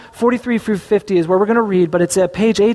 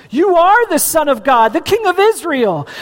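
awed exclamation; 9 semitones higher than usual. A narrator's reading. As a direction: exclaim it in wonder, a touch higher. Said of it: "Then Nathanael exclaimed".

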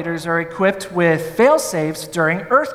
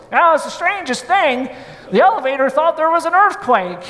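put-on higher, folksy voice of a little old man; old technician; 7 semitones higher than usual. Old mustached technician explains the glitch, higher puzzled folksy voice. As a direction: play the puzzled old repairman. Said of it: "and he goes".

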